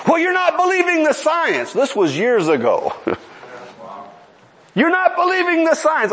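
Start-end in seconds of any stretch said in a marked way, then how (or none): 0.1-1.7 high, stressed NOT in a mock rant; angry priest. 4.7-6.1 high, stressed NOT in a mock rant; angry priest.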